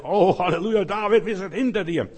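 loud jubilant hallelujah cheer; cheering crowd. Acted. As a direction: shout it like fans on a winning streak. Crowd cheering David voiced.

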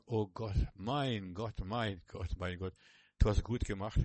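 soft, reverent thanks to God; awed whisper to God. Pretend. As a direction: breathe it out in quiet awe. Imagined awed prayer to God.

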